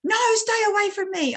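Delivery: high-pitched mock wail of refusal; upset child imitation. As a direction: push them away like a crying child. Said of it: "hunched up in a circle and started crying".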